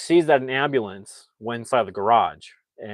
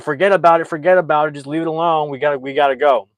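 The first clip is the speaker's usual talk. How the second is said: raised urgent plea; 4 semitones higher, 6 dB louder, faster than usual. Retold or imitated. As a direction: push him to leave now. Danny urging brother to flee.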